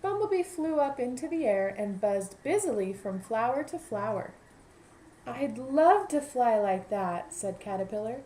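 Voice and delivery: lively picture-book narration; storybook voice for young listeners; reading to children. A narrator's reading. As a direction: read it aloud to a class of kids. Teacher reads story to class.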